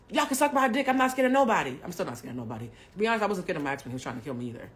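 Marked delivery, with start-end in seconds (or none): 0.1-1.8 higher and louder, full of swagger; brash tough talk. Retold or imitated. Recalling her younger self daring everyone, loud and brash. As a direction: play your old cocky, fearless self.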